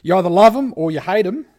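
loud fast teaser line; punchy announcement. Acted. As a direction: hype it up. Dramatic segment intro.

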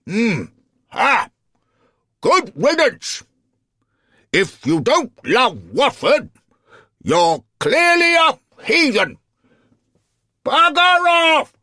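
very high, loud shouting from an outraged alderman; angry shout. Acted. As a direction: bellow it after someone walking away, building to the last words. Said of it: "He heard the alderman shout".

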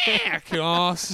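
slow, loud, high Scouse reply; mock Scouse accent. Co-host joins the Scouse accent joke. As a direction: join in the Scouse bit.

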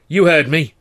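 loud, high and cutting; snarl. Acted. Actor nose to nose with his boss, snarling. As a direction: spit it in his face. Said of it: "I snarled".

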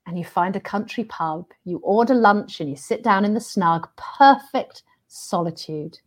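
hushed host narration; game show reveal. Acted. Host reads the hidden prize.

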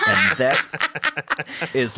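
talking through real laughter; laughing. Real, not acted. Game master cracking up over Clunk's bigger rock, laughing. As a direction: break up laughing mid-narration.

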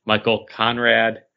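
ordinary delivery

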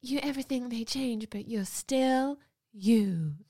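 drawn-out, mock-poignant quote; Sarah Jessica Parker. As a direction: stretch out the last words as a mock profound voice-over. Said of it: "when Sarah Jessica Parker says".

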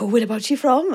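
playfully over-keen question; mock excited. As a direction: act thrilled to meet a fellow countryman. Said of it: "you get all excited".

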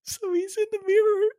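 high-pitched speech breaking into helpless laughter; barely getting words out through laughs; laughing hard. Real, not acted. Describing the bathroom TikTok to viewers, crying with laughter. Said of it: "I'm crying right now".

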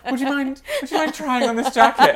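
very high, imploring woman's voice; high mock-polite request through laughter; pleading stranger. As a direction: imitate a woman asking a cheeky favour. Voices woman asking Dad a favour.

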